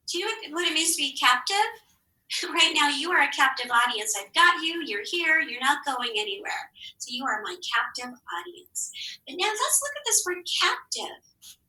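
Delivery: ordinary talk, nothing marked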